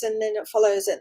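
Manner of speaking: usual speaking voice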